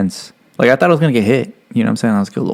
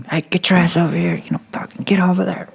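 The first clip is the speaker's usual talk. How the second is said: higher, hammed-up barking order; mock drill instructor; 9 semitones higher than usual. Recruit retells instructors' orders in mock bark. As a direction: play the instructor herding recruits. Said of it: "they're like".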